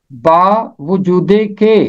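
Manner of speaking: बावजूदे के said slowly, every syllable clear; teacher repeating बावजूदे के slowly; careful pronunciation. Shown as a demonstration. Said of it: "इसका तलफ़ुज़ है बावजूदे के".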